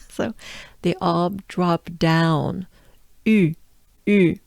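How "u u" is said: "U" with lips rounded into a small tight opening, jaw dropped; "U" like blowing into a trumpet; French U. Shown as a demonstration. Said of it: "very small opening of your mouth".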